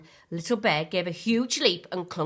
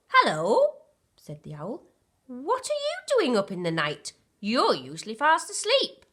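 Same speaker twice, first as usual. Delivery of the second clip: friendly owl voice, higher and louder; lightly voiced owl; 6 semitones higher, 5 dB louder than usual. A narrator's reading. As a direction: greet them as a chirpy little owl. Owl character voice.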